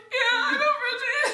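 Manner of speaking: high, loud, drawn-out giggly reply; mock tearful excitement; overwhelmed, laughing. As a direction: squeal it slowly through laughter. Acting out her tearful phone reply.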